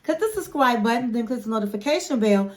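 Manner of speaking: ordinary talk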